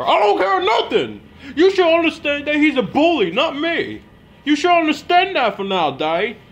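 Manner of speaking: very high, loud shouted protest; yelling. Acted. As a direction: scream it at him, pitch cracking high. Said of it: "stop yelling at me".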